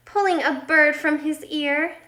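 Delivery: ordinary delivery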